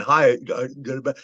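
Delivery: imitating slurred, garbled talk; slurred speech. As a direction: let the greeting dissolve into mumbled syllables. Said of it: "he would slur his words".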